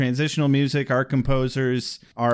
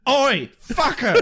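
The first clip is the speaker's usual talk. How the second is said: slow, higher, cheeky London "oi"; hammed-up Cockney jeer; mock Cockney oi; 10 semitones higher than usual. Host offers a rude mock Cockney greeting. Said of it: "or better yet".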